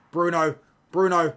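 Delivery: loud urgent call, high and sharp; yelling a name. Real, not acted. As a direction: shout at the screen for him. Yelling at a player on screen.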